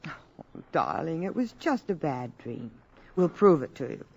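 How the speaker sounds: soft comfort. Acted. Mother comforting frightened son.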